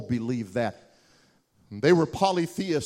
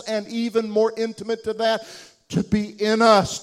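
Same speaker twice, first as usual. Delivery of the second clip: impassioned preaching; 5 semitones higher, 3 dB louder than usual. Acted. Preaching at full force.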